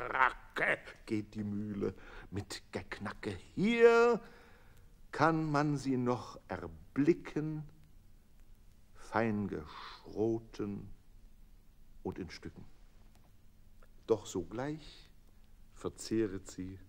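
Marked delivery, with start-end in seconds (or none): none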